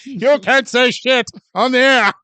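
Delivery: put-on high, slow old-timey announcer; 1940s radio announcer. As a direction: scold in vintage radio voice.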